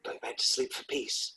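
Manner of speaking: soft, breath-only question; acted whispered query; hushed hope. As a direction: ask it in a hopeful bedtime whisper. Acting hushed bedtime question.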